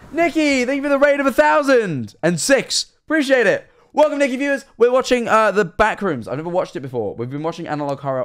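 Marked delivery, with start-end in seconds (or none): 0.1-6.3 loud, high hype greeting; big streamer welcome shout; excited. Real, not acted. Thanking a raider, hyped.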